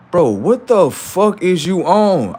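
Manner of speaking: stunned and loud; incredulous. Acted. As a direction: drag it out in disbelief. Incredulous outburst.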